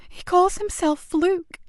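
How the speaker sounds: put-on slow, high hushed child's voice; frightened boy. Boy naming his attacker, slow and fearful. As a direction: speak the name with dread.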